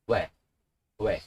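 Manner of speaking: "we" as o and e run quickly together; each "we" one short syllable; vowels blended fast. Shown as a demonstration. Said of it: "Make it fast".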